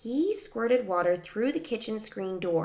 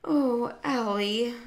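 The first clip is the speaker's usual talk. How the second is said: higher, dismayed moan; 4 semitones higher, slower than usual. A narrator's reading. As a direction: moan it in weary disappointment. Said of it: "moaned Mom".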